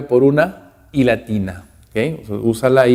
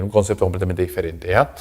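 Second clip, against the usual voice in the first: ordinary talk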